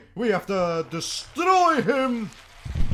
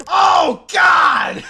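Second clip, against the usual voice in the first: a much louder, higher cry breaking into a shrieking laugh; loud startled yell into laughter; 11 semitones higher, 13 dB louder than usual. Real, not acted. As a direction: yell then laugh hard. Crash then laughs.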